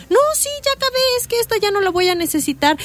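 put-on high little-boy voice, matter-of-fact; child voice. Son telling mom he's done, high child voice. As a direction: play the son, unbothered.

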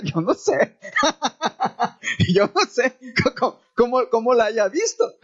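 chuckling through the words; amused, speaking through real laughter; laughing. Real, not acted. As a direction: laugh while you get it out. Laughing while retelling a compliment.

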